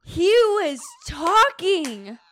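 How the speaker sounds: loud slow realization. Real, not acted. Friend reacts, loud and slow.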